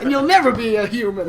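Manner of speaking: high, loud taunt through laughter; hammed-up insult, cracking up; mock trash talk. Joking taunt to bot players, laughing mockery.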